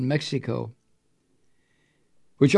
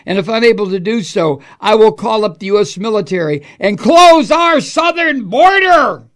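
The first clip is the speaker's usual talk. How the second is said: higher, louder pompous read; mock Trump quote; 6 semitones higher, 7 dB louder than usual. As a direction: puff up like Trump threatening.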